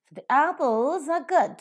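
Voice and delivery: apples and good stressed, the weak. Shown as a demonstration. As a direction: model sentence with weak the and are. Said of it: "you could listen at the higher level, only apples and good".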